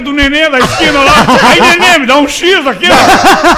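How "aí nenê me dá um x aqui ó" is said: hammed-up call to the barman for a sandwich; mock bar order. Customer calling out to the barman at the corner bar, mock loud.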